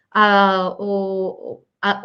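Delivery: usual speaking voice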